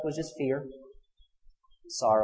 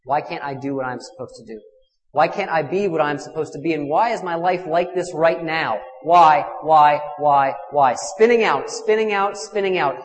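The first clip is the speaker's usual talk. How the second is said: louder and louder furious cry of why; angry prayer; 3 semitones higher, 8 dB louder than usual. Retold or imitated. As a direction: rail at heaven in rage. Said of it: "All anger at myself".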